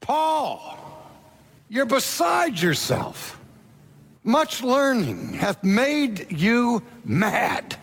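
high, slow accusing cry; a governor losing patience, crying out high and slow; loud voice. Retold or imitated. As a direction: call him mad from the bench, high and slow. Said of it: "and with a loud voice".

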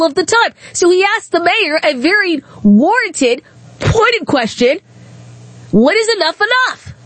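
real exasperated outrage at the mayor; indignant rant. Real, not acted. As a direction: defend the reporter, fired up.

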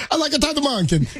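high fake Italian fan voice; playful Italian fan bit; mock Italian accent.

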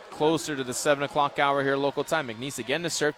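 normal talking voice

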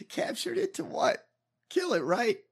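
high squeaky laugh through the words; cracking up while asking; laughing disbelief. Real, not acted.

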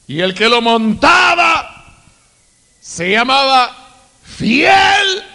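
slow shouted reading; proclaiming scripture. Real, not acted. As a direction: read it out like a herald. Preacher proclaiming Revelation vision.

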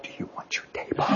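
hushed, unvoiced whisper; timid whispered offer. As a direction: whisper it like you're sheepish to ask. Diners offer his table.

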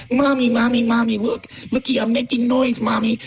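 put-on high, far-off little kid voice calling for mommy; sneering at drummers by playing a child begging for attention; mock whiny child. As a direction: ham it up as a bratty toddler. Mocking drummers as needy children.

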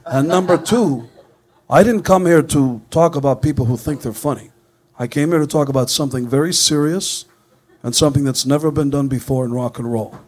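a hint of Gene pitching his casket; [name] voice. Gene sells the casket to the radio hosts, lightly voiced.

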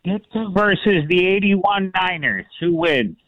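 put-on SNL-style Chicago accent; Chicago superfan accent. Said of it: "With my best Chicago accent from S N L".

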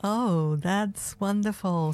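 normal talking voice

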